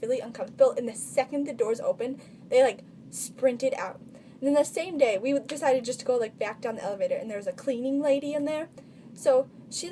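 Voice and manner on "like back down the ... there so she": usual speaking voice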